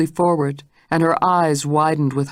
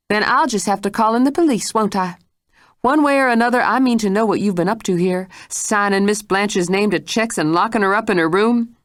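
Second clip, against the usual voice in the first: higher, pressing accusation; threatening Mrs Stitt; 6 semitones higher than usual. Acted. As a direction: threaten to call the police.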